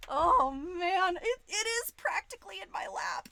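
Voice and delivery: high, loud rush of delight; excited shout. Real, not acted. Streamer to chat about the screen in her lap, thrilled shout. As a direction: blurt it, overjoyed.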